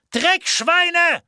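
loud, high-pitched yelled insult; furious scream. A narrator's reading. Walli distracting the border guards, furious scream. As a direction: scream it to draw their attention. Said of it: "schrie Walli".

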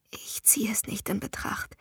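barely whispered denial. A narrator's reading. Laurie whispers to her friend on the phone, whispered. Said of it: "flüsterte ich".

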